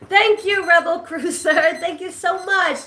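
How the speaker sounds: high, bright-voiced thank-you with a laugh; excited thanks. Real, not acted. Vlogger thanking a new subscriber, excited and high.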